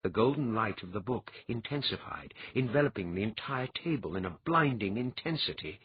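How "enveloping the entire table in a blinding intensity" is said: ordinary delivery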